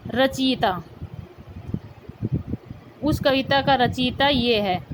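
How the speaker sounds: ordinary talk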